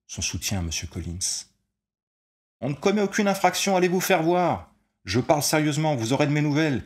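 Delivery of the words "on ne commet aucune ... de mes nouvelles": loud, much higher furious yell; angry shout. A narrator's reading. Player shouting back at the old man, angry yell. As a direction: yell it down at them in a rage. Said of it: "cria monsieur Collins".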